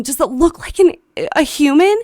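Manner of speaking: urgent question. Retold or imitated. Asking the neighbor about the figure, urgent. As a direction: call it out loudly to a neighbor.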